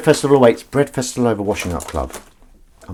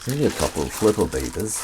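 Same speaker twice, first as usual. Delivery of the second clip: quote in voice; 3 dB quieter than usual. Retold or imitated. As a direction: quote said in a different voice. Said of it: "do them in the voice".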